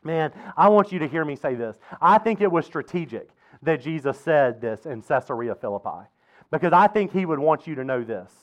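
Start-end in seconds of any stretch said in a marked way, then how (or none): none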